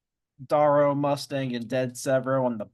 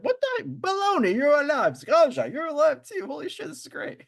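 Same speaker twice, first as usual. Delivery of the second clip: very high, louder excited greeting; excited squeal; 4 dB louder, faster than usual. Retold or imitated. Friend voices joyful reunion with Aja, very high squeal.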